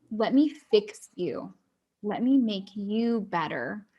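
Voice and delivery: slow, deliberate mock helpfulness; imitated fixer. People approaching someone after something terrible, insistently helpful. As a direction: space out each word like an insistent would-be rescuer. Mimicking people who try to fix.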